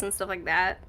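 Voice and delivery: ordinary talk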